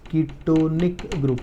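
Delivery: ketonic stretched syllable by syllable; drawn-out teacher's ketonic; slow dictation. Real, not acted. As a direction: say ketonic very slowly, as if writing along. Repeats the term while writing.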